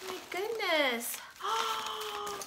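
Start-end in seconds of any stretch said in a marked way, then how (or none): 1.4-2.4 long high gasp of surprise; amazed gasp. Real, not acted. Woman opening a fan's gift, amazed gasp. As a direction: gasp out a drawn-out oh.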